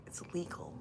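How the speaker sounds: soft whispered joke; whispering. Real, not acted. Whispered aside.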